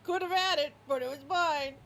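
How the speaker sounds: high, loud taunt; dog rubbing it in; loud smug dog; gloating dog. Acted.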